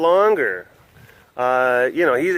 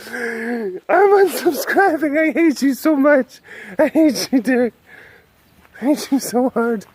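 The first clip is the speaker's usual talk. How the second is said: mock heartbroken viewer; 11 semitones higher, 4 dB louder than usual. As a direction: play a hurt fan sobbing at Derek. Mock viewer rejecting Derek.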